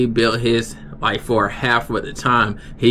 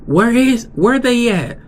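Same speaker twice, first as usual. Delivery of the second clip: high, loud imitated coworker voice; mimicked high voice; 12 semitones higher, 4 dB louder than usual. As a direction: squeak out the coworker's question. Coworker asking where they are.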